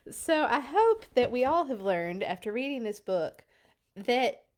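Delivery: usual speaking voice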